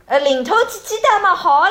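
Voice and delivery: loud, high bargaining call with bounce; haggling customer. Pretend. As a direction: ask to drop the change with rhythm. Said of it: "要有一点那种节奏感在里面".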